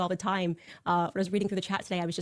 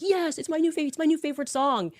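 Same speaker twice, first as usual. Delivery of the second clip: much higher, gushing with delight; excited Charlie; 9 semitones higher, 3 dB louder than usual. Retold or imitated. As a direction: squeal about the new favourite song. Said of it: "He's like".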